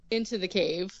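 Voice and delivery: ordinary talk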